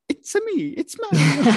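very high cartoon plumber voice; Mario voice. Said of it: "And then there's Mario, who's like".

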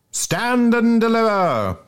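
high, loud schoolboy call; hearty schoolboy shout. Acted. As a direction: boom it out like a jolly schoolboy. Bob Cherry voiced in reading.

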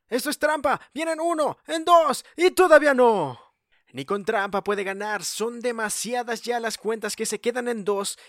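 excited play-by-play rising to near-shouting; wrestling commentator. Acted. Live play-by-play building to climax.

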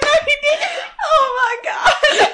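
high squeal breaking into laughter; loud mock shock, helpless with laughter; scandalised disbelief. Retelling a reaction to a lewd podcast quip, scandalised.

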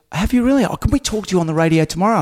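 high, eager imitation of a producer; imitated producer. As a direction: pitch it up and quicken into the invitation. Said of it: "And she goes".

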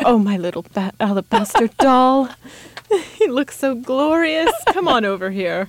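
lightly hammed-up newsman voice talking to a cat; Ron Burgundy impression. Co-host shows how she talks to her cat, a light Ron Burgundy voice. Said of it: "more like Ron Burgundy from Anchorman".

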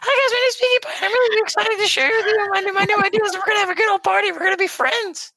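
very high, fast, bubbly pony voice; squeaky excited cartoon pony; Pinkie Pie voice. Shown as a demonstration. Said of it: "so just do it again".

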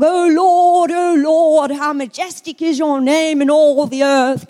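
loud, put-on Pommy-accented church prayer; mock pompous praying, drawn out to sound important; big prayer voice. Traditional Baptist churchgoer praying a big monologue, mock-important English accent. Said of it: "put on the big prayer voice".

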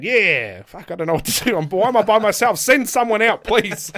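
high, loud, mounting mock desperation; retold comic panic. Wrestler alone in the ring begging for company, comically panicked. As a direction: build from a cheer to a loud plea.

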